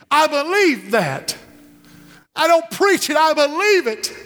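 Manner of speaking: high, slow, fervent declaration; impassioned conviction. Real, not acted. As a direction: lift it up with conviction. Preacher rising in conviction.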